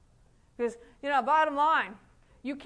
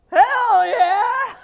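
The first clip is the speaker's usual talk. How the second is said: retold shout; 7 semitones higher, 12 dB louder than usual. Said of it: "And I went".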